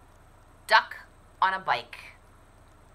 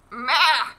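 higher bleating meh; playful goat meh; 9 semitones higher, 17 dB louder than usual. Acted. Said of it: "said Goat".

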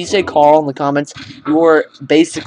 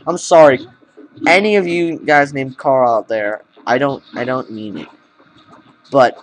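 ordinary talk

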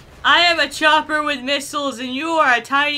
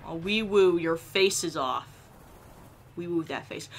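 ordinary talk, nothing marked

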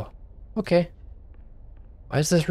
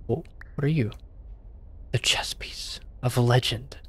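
awed whisper; 6 dB quieter than usual. Pretend. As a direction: whisper it like a legendary treasure. Awe at a found chess piece.

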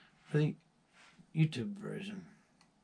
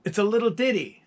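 put-on Scottish accent; 9 semitones higher, 6 dB louder than usual. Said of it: "the guy was saying".

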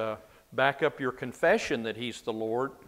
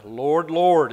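"Lord, Lord" loud, both words weighted; emphatic doubling; 3 semitones higher, 10 dB louder than usual. Retold or imitated. People appealing on that last day, "Lord, Lord" loudly emphasized. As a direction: call out "Lord, Lord" with strong stress on each. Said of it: "the way people are gonna emphasize".

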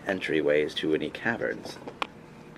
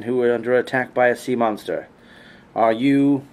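loud, higher, proclaimed introduction; formal announcement; 4 semitones higher, 6 dB louder than usual. Acted. Wood elf introduces herself through a barricaded door, loud formal announcement. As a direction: call out your name and purpose.

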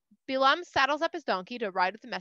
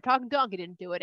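plain reading voice

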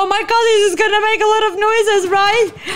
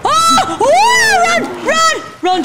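high, loud urgent yells; real frantic warning; panicked shout; 11 semitones higher, 4 dB louder, slower than usual. Real, not acted. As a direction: scream at him to run. Urging her partner to flee.

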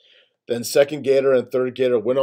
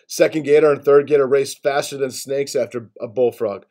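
ordinary delivery

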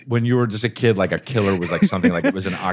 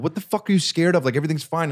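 higher, exasperated mock dad; frustrated dad; 9 semitones higher than usual. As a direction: play the fed-up father, lightly. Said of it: "my dad being so frustrated".

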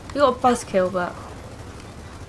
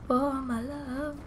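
slow overblown heroic cry; dramatic hero; 3 semitones higher, 10 dB quieter, much slower than usual. Pretend. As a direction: ham it up like a doomed hero. Dramatic line while jumping.

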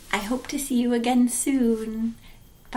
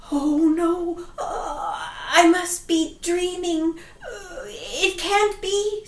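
high, trembling, near tears; frightened old man almost crying; terrified old man; 3 semitones higher, slower than usual. Acted. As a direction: whimper it in disbelief. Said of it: "said the old man".

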